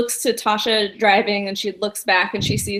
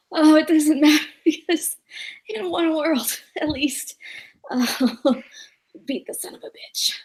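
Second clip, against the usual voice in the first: whispered rasp broken by laughs; hushed raspy voice, breaking into laughter; 6 semitones higher than usual. Acted. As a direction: force it out in a hoarse whisper, laughing between phrases. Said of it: "voice is kinda raspy".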